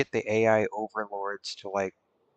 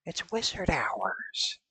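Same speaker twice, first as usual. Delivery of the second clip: mystic wizard whisper; 11 semitones higher, 5 dB louder than usual. Acted. Playful wizard bit.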